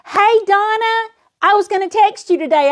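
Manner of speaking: high, bright hello to a friend; excited greeting. Real, not acted. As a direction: greet her happily. Greeting a viewer by name.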